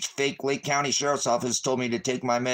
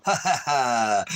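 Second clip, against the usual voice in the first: mocking laugh; 2 semitones higher, slower than usual. Real, not acted.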